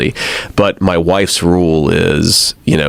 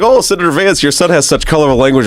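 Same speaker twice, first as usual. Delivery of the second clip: mock passenger; 10 semitones higher than usual. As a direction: imitate a snooty passenger. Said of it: "it's like".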